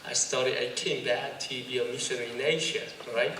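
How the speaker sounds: slow, casual American English; laid-back American accent, drawn out. Shown as a demonstration. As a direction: pose as a Korean-American, unhurried. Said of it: "미국 사람 같다".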